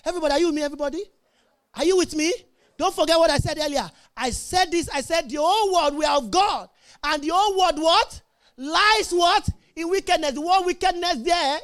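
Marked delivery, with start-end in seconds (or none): none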